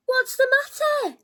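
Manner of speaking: anxious giant; concerned call. Acted. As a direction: ask the crying fox gently but urgently. George asks the crying fox.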